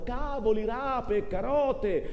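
lightly sung-out market call, slow; a hint of hawking vegetables; street vendor's cry. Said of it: "che grido a perdifiato". (richiamo da venditore ambulante, appena accennato)

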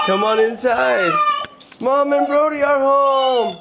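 high, slow, drawn-out sing-song. Real, not acted. Dad greeting the dog and family, high sing-song. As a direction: coo at the dog like a little one.

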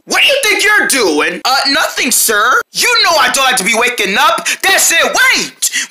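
normal talking voice

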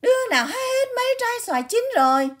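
put-on very high, fast, shrill old woman; shrill grandma. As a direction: shriek it like a cross grandmother. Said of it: "Tiếng bà ngoại nó lanh lảnh".

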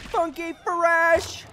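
high, very slow sing-song "funky, fresh"; hammed-up funky voice; silly drawn-out. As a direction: stretch it out goofily. Silly teasing question to viewers.

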